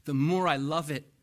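ordinary talk, nothing marked

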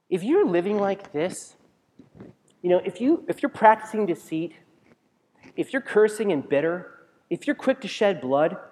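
rising, loud preaching; preaching at full force. Acted. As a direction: drive it home to the pews.

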